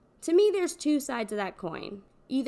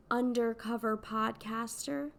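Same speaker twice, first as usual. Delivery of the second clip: normal talking voice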